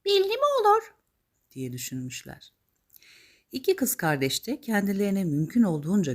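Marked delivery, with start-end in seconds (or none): 0.0-0.9 very high, loud character voice; hopeful stepsisters piping up high and fast; squeaky, excited fairy-tale sisters; scheming stepsisters. Acted. Stepsisters thinking aloud in the tale.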